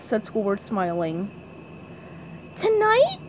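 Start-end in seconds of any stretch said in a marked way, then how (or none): 2.6-3.2 high, squeaky hopeful question; SpongeBob. Acted. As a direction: ask it in an eager pipsqueak voice. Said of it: "asked SpongeBob".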